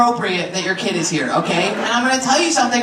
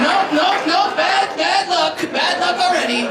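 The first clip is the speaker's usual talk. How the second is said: higher, hurried nope nope; mock panic; 6 semitones higher than usual. Comic cancelling his own party, higher and hurried. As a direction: back away from bad luck. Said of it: "I was like".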